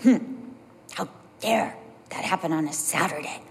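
a hint of an indignant churchgoer; mock indignation. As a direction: sniff at it primly. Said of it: "We're not indignant, like".

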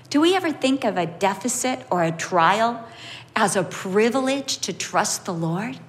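ordinary talk, nothing marked

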